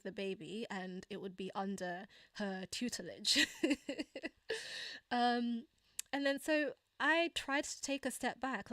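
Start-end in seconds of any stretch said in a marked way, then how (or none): 3.5-5.0 light laugh. Real, not acted. Laugh at own story.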